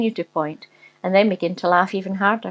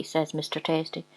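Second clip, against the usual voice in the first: ordinary delivery, nothing marked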